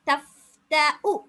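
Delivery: தஃப்தஉ very slow, ending on a short உ; slow recitation. Shown as a demonstration. Teacher modelling the hamza ending for students. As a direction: chant தஃப்தஉ slowly, with no வ. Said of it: "வாவ் என்று சொல்லி நீட்ட போறீங்களா".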